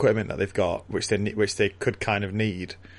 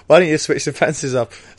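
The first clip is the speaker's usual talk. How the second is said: raised, louder acted question; questioning suggestion; 5 semitones higher, 5 dB louder than usual. Worker asking foreman about the fences, raised questioning voice. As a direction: put the obvious question to the boss.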